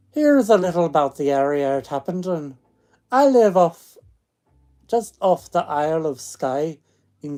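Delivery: loud storytelling in a put-on Scottish accent; attempted Scottish accent. Streamer reads a ghost story to listeners, attempted Scottish accent. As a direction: read it out in an amateur Scottish accent. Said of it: "I could do a Scottish".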